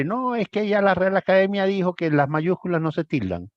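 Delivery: mocking student quote. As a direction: parrot the students as if they were smug. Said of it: "los estudiantes cogieron la costumbre".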